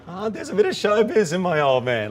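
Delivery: put-on amused, lifted "showbiz in my old man"; bright amused quote; delighted exclamation. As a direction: marvel at the old man's flair. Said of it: "I'm like".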